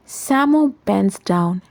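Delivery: usual speaking voice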